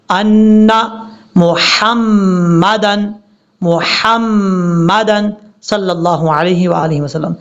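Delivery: मोहम्मदन slow, the ह light and clear; correct reading. Shown as a demonstration. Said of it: "इस तरीके से पढ़ना है।". (मोहम्मदन को हल्के ह के साथ पढ़ना)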